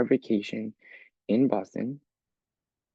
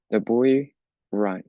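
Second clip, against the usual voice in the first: slow example sentence; 4 dB louder, slower than usual. Shown as a demonstration. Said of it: "it's a sentence".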